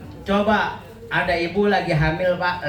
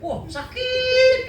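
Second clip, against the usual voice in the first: whiny, very high and slow "sakit"; mock wife whining; much slower than usual. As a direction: ham up a drawn-out complaint. (istri merengek kesakitan, tinggi dan lambat)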